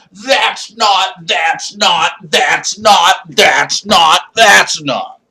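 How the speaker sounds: loud, stuttering mock outrage; mock sputtering. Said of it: "Did you hear that meme?".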